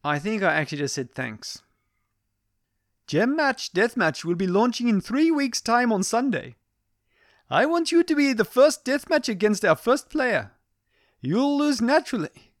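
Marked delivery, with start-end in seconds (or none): 3.1-10.5 put-on high, commanding Indian accent, louder. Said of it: "her commanding tone". 11.2-12.5 put-on high Indian-accented "naturally"; "You'll lose naturally" in a put-on Indian accent, high and loud. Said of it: "Oh dear, that didn't work".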